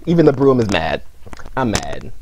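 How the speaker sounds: low, softened grievance; sulking mad. Real, not acted. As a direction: let it drop to a low, sullen murmur.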